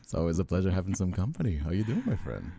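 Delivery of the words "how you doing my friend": ordinary talk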